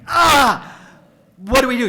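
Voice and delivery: high, loud yelp of alarm; panicked yell. Retold or imitated. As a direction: cry out in a sudden panic. Panicking at broken water.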